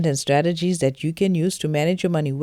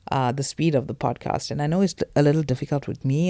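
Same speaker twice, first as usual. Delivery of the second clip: usual speaking voice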